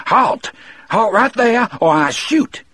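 high, reedy but strong warning shout; reedy yell. A narrator's reading. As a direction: yell it thin and high, with force behind it. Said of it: "yelled in a voice that was reedy but strong".

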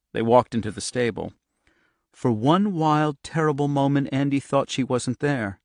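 plain reading voice

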